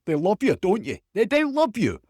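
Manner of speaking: high, loud Scottish reporter pleading; Scottish accent. Acted. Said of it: "some of my Scottish accent here".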